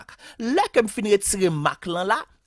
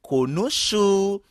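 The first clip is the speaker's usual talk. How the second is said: konouchou stretched out slowly; slow, drawn-out model of konouchou; slow demonstration; much slower than usual. Shown as a demonstration. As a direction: sound out konouchou slowly for learners. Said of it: "C'est ça qui fait le vite tourner".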